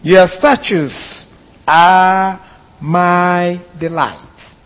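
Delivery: solemn, drawn-out reading of the verse; unhurried formal recitation of scripture; slow scripture reading. Shown as a demonstration. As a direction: read the verse slowly and deliberately, each word given weight. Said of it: "verse twenty-four reads".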